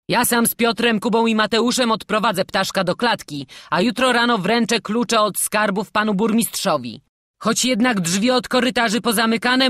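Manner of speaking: loud, high commander's voice; giving orders. A narrator's reading. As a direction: issue orders to the whole guard. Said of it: "rozkazy wydawane przez dowódcę straży". (głośne rozkazy dowódcy)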